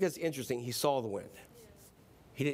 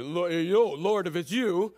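slow, put-on character voice; drawn-out, put-on call to the Lord; [name]'s "right" voice; slower than usual. Shown as a demonstration. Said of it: "let me do it in the right voice".

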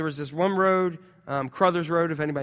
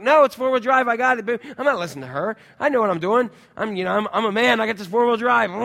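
cocky; 5 semitones higher, 5 dB louder than usual. Retold or imitated. His cocky retort to wife.

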